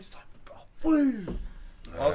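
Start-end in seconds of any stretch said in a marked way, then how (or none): 0.8-1.4 loud, airy, high burst of noise; voiceless storytelling sound effect; imitated whoosh of impact. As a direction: mimic the blow with a sudden puff. Imitating being hit in story.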